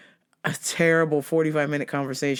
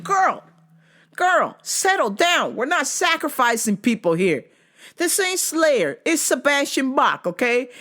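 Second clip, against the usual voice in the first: sassy scolding; 8 semitones higher than usual. Retold or imitated. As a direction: tell her off with attitude. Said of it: "and I was like".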